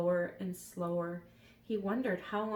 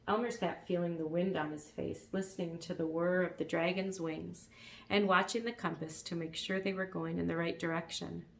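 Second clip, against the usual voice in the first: usual speaking voice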